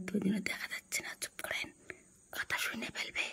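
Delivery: hushed whisper so they won't hear. Real, not acted. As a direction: whisper as if hiding from guests. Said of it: "আস্তে আস্তে বলতেছি শুনতে পাবে".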